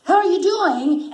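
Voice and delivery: high, loud, chopped-up greeting; overeager, choppy cartoon greeting; truncated dubbing delivery. Shown as a demonstration. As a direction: punch out each bit to hit the cue. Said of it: "And it's all, like, truncated".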